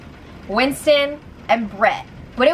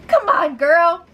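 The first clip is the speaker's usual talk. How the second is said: higher, louder plea; exasperated come on; 6 semitones higher, 4 dB louder, slower than usual. Real, not acted. Host exasperated with Caitlyn, higher pleading come on. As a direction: urge her, fed up.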